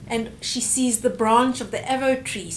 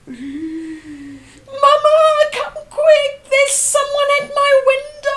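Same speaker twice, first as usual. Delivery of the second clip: much higher, louder frightened scream; child screaming for mother at night; panicked child's cry; 9 dB louder, slower than usual. Acted. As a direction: shriek for mama in fear. Scared child calls mother.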